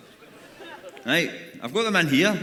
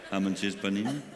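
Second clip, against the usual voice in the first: mock cabin crew; 8 semitones lower, 5 dB quieter than usual. Continuing the crew announcement.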